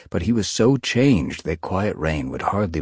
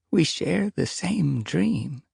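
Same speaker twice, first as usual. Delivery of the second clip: ancient she-cat; 11 semitones higher, slower than usual. Acted. Stoneteller speaks.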